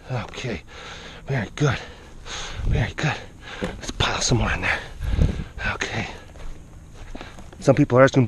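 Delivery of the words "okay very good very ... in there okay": low, soft, gentle praise. Real, not acted. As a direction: praise them quietly.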